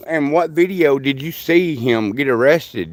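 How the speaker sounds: ordinary talk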